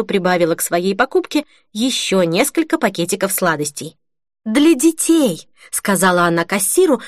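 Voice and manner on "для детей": higher, drawn-out announcement; pointedly audible. A narrator's reading. Holly to the cashier so the whole queue hears, pointedly audible. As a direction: say it slowly for everyone to hear. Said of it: "но так, чтобы слышали все".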